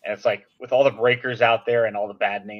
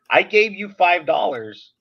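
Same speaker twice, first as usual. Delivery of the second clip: slower, higher, insistent protest; exasperated insisting; 6 semitones higher, 5 dB louder, slower than usual. Retold or imitated. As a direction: spell it out to the seller, fed up. Retold argument with the seller.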